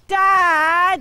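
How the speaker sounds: put-on a kid shouting to embarrass his dad; loud squeaky voice. Joseph calling his dad in public, loud and squeaky. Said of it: "in this loud, squeaky voice".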